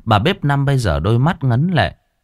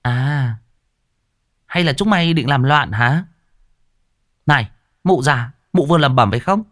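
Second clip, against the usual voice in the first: sudden glare; 5 semitones higher than usual. A narrator's reading. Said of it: "mụ chợt quắc mắt".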